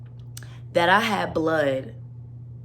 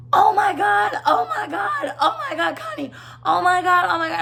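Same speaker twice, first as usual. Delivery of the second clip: much higher, loud crying shriek of horror; panicked screaming; 12 semitones higher, 3 dB louder than usual. Retold or imitated. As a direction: shriek it through tears at the sight of blood. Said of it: "she just starts screaming".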